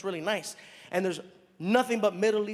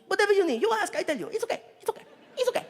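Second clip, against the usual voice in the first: much higher, laughing dismissive driver voice; driver reassuring; 12 semitones higher, 3 dB louder, much faster than usual. Retold or imitated. A driver dismisses the comedian's question, high and laughing. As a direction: wave off the worry with a chuckle.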